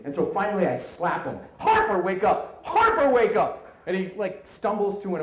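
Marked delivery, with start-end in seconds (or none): 1.6-2.6 loud, raised-pitch shout; shouted wake-up call. Retold or imitated. Manager slapping a passed-out colleague awake, first shout. 2.6-3.7 very high, very loud yell; louder wake-up yell. Retold or imitated. Manager trying harder to wake a drunken colleague, full yell.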